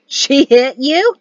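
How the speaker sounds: loud, high, drawn-out disbelief; shocked exclamation. A narrator's reading. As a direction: exclaim it in slow astonishment. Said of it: "Mari exclaimed".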